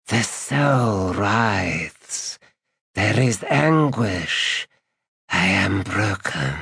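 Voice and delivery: very slow, anguished undead dragon; sibilant dragon voice. Acted. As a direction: drag each word out in pain. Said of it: "The dragon's sibilant voice".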